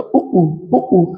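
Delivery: उ with rounded, pushed lips; lip vowel demo. Shown as a demonstration. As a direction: repeat उ with busy lips. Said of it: "ओष्ठ की क्रियाशीलता ज्यादा है".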